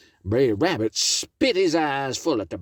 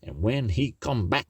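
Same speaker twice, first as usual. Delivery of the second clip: ordinary delivery, nothing marked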